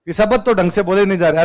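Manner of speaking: ordinary talk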